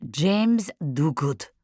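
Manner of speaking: put-on slow, weighty French-accented name; Marie-Claude. A narrator's reading. Revealing who telephoned, slow French accent. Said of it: "Marie-Claude's accent".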